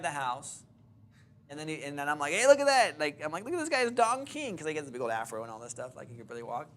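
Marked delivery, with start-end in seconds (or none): 2.3-3.0 loud, high jeering call; mock him across the street, pitched up and loud. Teasing the old man across the street, loud mocking jeer. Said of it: "I'm mocking this fool". 3.4-4.6 mocking. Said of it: "I'm mocking this fool".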